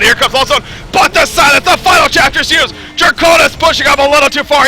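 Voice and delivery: shouted, high-pitched live commentary; excited play-by-play. Acted. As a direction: yell the action as it happens, loud and high.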